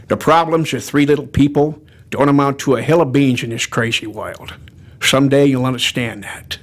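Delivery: low, slurred film tough guy; Humphrey Bogart. Acted. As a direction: slur the s like an old movie star. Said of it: "a little bit of Humphrey Bogart".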